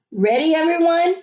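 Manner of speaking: cheerful call to the class; sing-song teacher. A narrator's reading. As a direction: sing it out brightly. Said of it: "sang out Missus O".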